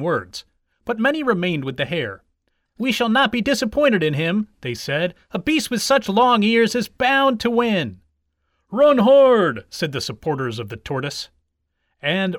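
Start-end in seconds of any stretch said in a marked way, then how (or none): 2.8-4.5 higher-pitched, insistent declaration; loyal supporters. A narrator's reading. Supporters who remained with the hare, staunch. 5.3-8.0 higher, louder confident proclamation; loyal supporters. A narrator's reading. Supporters who remained with the hare, staunch. Hare's supporters declare faith. 8.7-9.7 loud, high rallying cry; cheering shout. A narrator's reading. Supporters of the tortoise urging him on, shouting.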